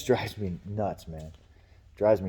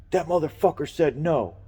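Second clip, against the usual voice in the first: retold coworker complaint; 7 semitones higher, 3 dB louder than usual. Coworker texts angry complaint.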